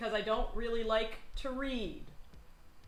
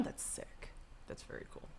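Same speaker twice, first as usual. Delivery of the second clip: whispering; 18 dB quieter than usual. Real, not acted. Whispered aside.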